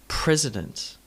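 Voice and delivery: president with PRES strong, the rest weak; PRES-ident, firmly front-stressed; first-syllable stress. Shown as a demonstration. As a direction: hit the start of president. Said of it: "with emphasis on the first syllable".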